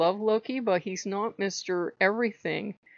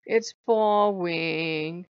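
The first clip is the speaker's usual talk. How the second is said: very slow, stretched-out imitation; mock bored complaint; much slower than usual. God tired of the game he wanted, mock bored drawl. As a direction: drag the words out like a bored complainer.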